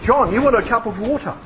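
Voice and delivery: normal talking voice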